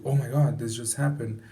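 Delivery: loud, startled outburst; alarmed surprise. Shown as a demonstration. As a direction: gasp it out like something just happened. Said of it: "you are surprised".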